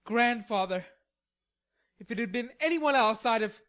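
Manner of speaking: fuming at his grandfather; angry Laurie. A narrator's reading. Angry Laurie voiced in story.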